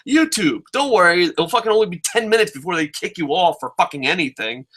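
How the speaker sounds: high-pitched, worked-up scolding; indignant tirade, easing toward the end; exasperated rant. Real, not acted. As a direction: tear into the commentators, pitch up. Ranting at the commentators directly.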